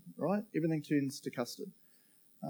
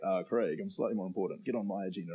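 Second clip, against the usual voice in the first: lower, softer, deadpan voice of God; God's dry reply; 6 semitones lower, 5 dB quieter than usual. Pretend. God gently putting hurried Craig in his place, low and dry. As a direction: answer him calmly from above, unimpressed. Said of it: "And he's like".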